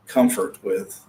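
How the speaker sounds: ordinary talk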